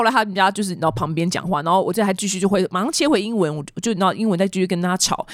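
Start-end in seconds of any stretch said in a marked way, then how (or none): none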